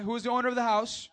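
high, loud call-out to the crowd. Shown as a demonstration. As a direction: demand the answer. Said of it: "Say it like we know it".

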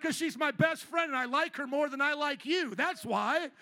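loud, high mock-indignant comeback; exaggerated retort. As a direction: fire back at the buddies, hammed-up and loud. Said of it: "I didn't say it like that".

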